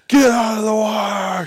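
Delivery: high, loud, drawn-out warning yell; frantic shout. Retold or imitated. Beachgoers yelling at the swimmer near the shark, loud high warning. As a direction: bellow it to save her. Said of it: "They're like".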